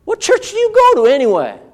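imitating an evasive unbeliever; mock dismissive questioner. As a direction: lightly voice a skeptic brushing it off. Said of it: "they go".

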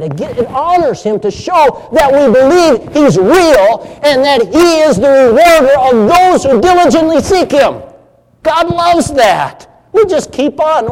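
high, loud preaching cry; fervent shouted climax. Real, not acted.

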